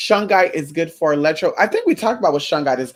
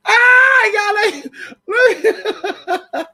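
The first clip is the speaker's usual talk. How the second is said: laughing shriek; 5 dB louder, much slower than usual. Real, not acted. Laughing outburst.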